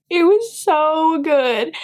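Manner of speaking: high and slow swooning; gushing. Real, not acted. Booktuber swooning to viewers over the romance. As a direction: melt over how good it was.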